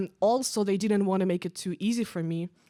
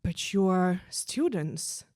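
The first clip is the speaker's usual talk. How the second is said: slow stunned reply; much slower than usual. Retold or imitated. Guy grasping for excuse.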